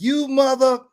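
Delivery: high, loud mock yell of an angry woman. Woman yelling at the speaker in a parking lot, high angry mock yell. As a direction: shriek at him like a furious stranger. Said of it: "this woman is like".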